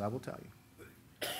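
whisper. Retold or imitated. Pastor answers young man gently.

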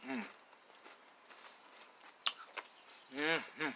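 chewing while humming; closed-mouth mm through food; mouth full. Real, not acted. Seen 3: lips closed and pursed, chewing while reaching into chip can.

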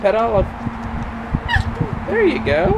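high loud praise; cheering on. Real, not acted. Cheering toddler on.

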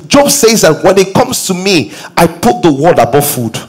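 fired-up preacher urging the congregation; preaching at full force. Acted. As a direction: pitch it up and push, like a pulpit climax.

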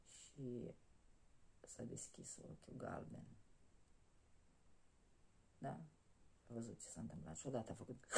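whispered, secret storytelling; hushed whisper. Acted. Telling how the sleeping dragon opened its eye, hushed whisper. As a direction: hush it like the dragon might hear.

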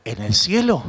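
very low, weighty pronouncement; solemn. Shown as a demonstration. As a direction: let it drop deep, with a long pause inside. Mock-solemn contrast before festive shout. (solemne, muy grave y pausado)

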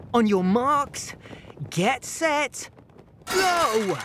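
high, rising countdown call; race starter robot. Acted.

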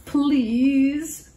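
a tiny goat shaking in its hooves; small scared plea; timid little goat. A narrator's reading. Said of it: "said the hoof-shaking tiny cabrito".

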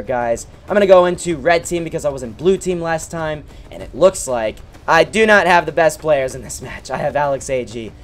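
usual speaking voice

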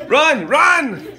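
high urgent yell; shouting. Real, not acted. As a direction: cry out to flee. Calling out in alarm.

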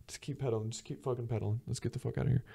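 hushed, under-the-breath urging; urgent aside. Retold or imitated. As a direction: mutter it discreetly, wanting to get away. Urging companion discreetly to escape.

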